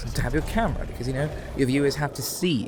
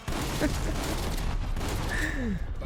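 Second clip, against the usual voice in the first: breathy laugh; 4 dB quieter than usual. Real, not acted.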